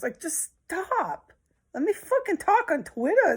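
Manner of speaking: high, squeaky mock of a know-it-all woman; mocking her. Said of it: "She's delusional. She thinks she knows".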